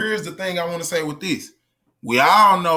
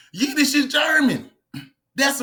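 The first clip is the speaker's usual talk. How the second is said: high-pitched incredulous cry; exasperated outburst; 8 semitones higher than usual. Real, not acted. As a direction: exclaim it high, as if it were obvious.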